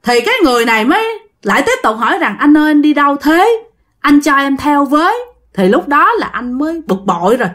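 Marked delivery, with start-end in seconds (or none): 2.4-5.2 put-on a ghost asking in a Northern Vietnamese accent, pitched up; ghostly Northern accent. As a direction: plead eerily in Northern accent. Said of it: "giọng đó là giọng Bắc". (giọng Bắc ma quái, cao hơn)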